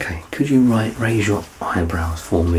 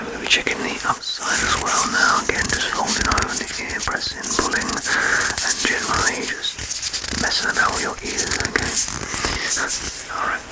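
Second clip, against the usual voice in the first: ASMR whisper. Acted. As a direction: whisper gently as you check the ear. Ear inspection roleplay whispered.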